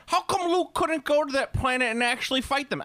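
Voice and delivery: high, whining mock outrage; mad fans. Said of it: "But then people are mad".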